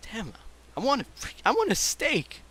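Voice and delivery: high-pitched, frustrated wishing; exasperated craving. Acted. Host imagines a diner tired of nuggets, high exasperated craving.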